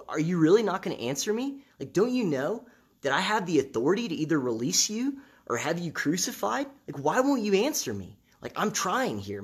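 exasperated Pilate. Retold or imitated. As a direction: press him, annoyed he won't answer. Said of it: "Pilate is kind of exasperated".

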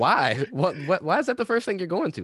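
high, disbelieving questions; incredulous. Retold or imitated. Incredulous imitation of friend questioning.

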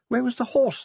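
high-pitched puzzled question; bewildered dwarf. A narrator's reading. Dwarf looking round for a horse, bewildered. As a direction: ask it high and baffled.

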